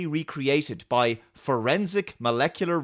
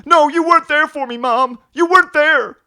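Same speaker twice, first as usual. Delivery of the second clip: high, shouted soap-opera outburst; hammed-up son yelling at his mother; aggrieved son; 13 semitones higher, 12 dB louder than usual. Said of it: "him being very skeptical about her".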